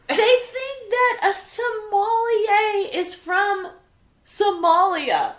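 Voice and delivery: slow, drawn-out punchline. Real, not acted. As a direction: spell the punchline out slowly.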